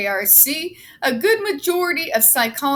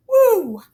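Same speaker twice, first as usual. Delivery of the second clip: excited whoop; 10 semitones higher, 8 dB louder than usual. Real, not acted. Pre-workout rush.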